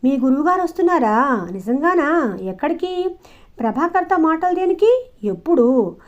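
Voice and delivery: high, loud, tumbling curious questions; eager questions. A narrator's reading. Shakuntala hearing her beloved's guru is coming, eager. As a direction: fire questions in eager excitement. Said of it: "మనసు కుతూహలంతో నిండిపోయింది".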